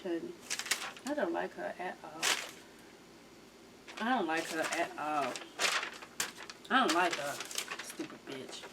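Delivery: low, hushed, deliberate disdain; muttered dislike. Real, not acted. Viewer grumbling about a woman on the show, muttered dislike. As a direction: let it sink under your breath, with long pauses.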